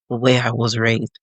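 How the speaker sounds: normal talking voice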